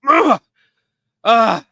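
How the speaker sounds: panting after drumming; out of breath. Real, not acted. Host winded from playing air drums, gasping. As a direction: catch your breath between sounds. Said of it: "I'm out of breath".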